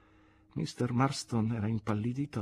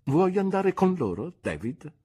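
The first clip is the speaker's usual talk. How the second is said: aunt asking gently; 9 semitones higher, 4 dB louder than usual. Acted. Aunt asks David.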